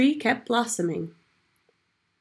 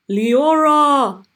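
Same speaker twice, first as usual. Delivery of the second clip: high, loud alarmed cry of a name; gasped cry; 6 semitones higher, 10 dB louder than usual. A narrator's reading. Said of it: "the king gasped".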